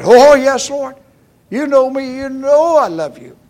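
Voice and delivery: high, loud protesting Peter; loud, high-pitched earnest assurance; emphatic protest. Acted. Peter's emphatic answer to Jesus.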